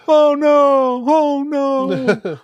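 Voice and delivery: high, loud sarcastic boo-hoo wail; mock fake crying. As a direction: ham up fake grief, high and taunting. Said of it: "Aemon's like".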